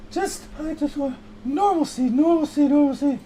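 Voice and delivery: mock scandalized parent yearning for normal times; fretting older woman. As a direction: whine about the modern world. Worried older person character.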